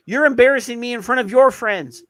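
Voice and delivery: loud, high-pitched mock outrage; mock scolding. Host re-does the telling-off of co-host, mock outraged. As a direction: cry it out as a hammed-up telling-off.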